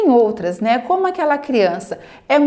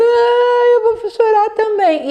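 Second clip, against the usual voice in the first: high, loud fake whimper of a parent; mock crying; 10 semitones higher, 5 dB louder, slower than usual. Parent fakes crying back at the child, hammed-up mock sob. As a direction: ham up a pretend cry to copy the child. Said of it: "Os pais começam".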